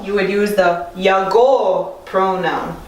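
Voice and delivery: ordinary talk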